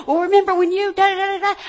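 mock fire back. Shown as a demonstration. Pastor acts out a spouse firing back, mock angry. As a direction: throw the old grievance back, hammed-up. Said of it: "Don't, don't fire back".

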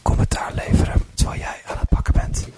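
breathy ASMR whisper. Shown as a demonstration. Doing ASMR for the podcast, whispering to Bart. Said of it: "Zo'n funzig fluisterprogramma".

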